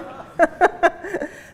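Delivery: loud, high laugh; laughing at her own story; real laugh. Real, not acted. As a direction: burst out laughing. Laughs after daughter's protest.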